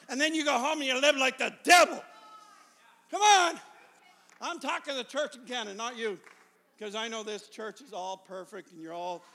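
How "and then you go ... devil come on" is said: loud, high, hammered preaching shout; full-force preaching. Real, not acted. Preacher rousing the church to come back to life, shouting. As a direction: drive each phrase home to the church.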